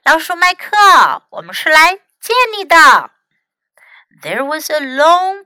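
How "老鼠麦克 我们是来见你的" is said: loud, raised storybook call; shouting. A narrator's reading. Tim calls to the rat inside the cabin, shouting. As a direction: call out to someone behind a closed door. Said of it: "他就大喊道".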